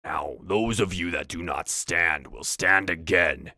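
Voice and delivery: slow, commanding orc voice; orc leader. Acted. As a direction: pronounce it as a dark decree. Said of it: "Valrul stops them and goes".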